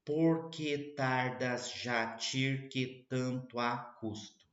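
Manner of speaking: very slow, drawn-out verse; slow reading. Shown as a demonstration. Teacher reading the poem differently to students, slow. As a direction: linger on each syllable of the blank verse. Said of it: "eu vou fazer uma leitura diferente".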